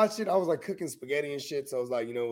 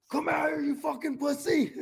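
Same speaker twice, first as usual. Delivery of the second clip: high, loud heckling yell; imitated yelling; 3 dB louder than usual. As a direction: mimic a troll yelling up at a building. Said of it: "was yelling at the building".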